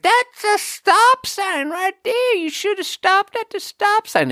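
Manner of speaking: hammed-up high, strained craggly geezer scolding; accusatory old man. Old man scolds a driver at the dump, accusatory high screech. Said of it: "he's real accusatory".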